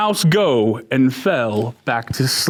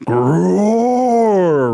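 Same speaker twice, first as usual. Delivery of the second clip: lion roar. Acted. Lion roaring at the mouse tickling him, mock roar. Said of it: "roared the lion".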